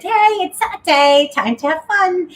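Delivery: a playful, mock English accent. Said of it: "talked in an English accent".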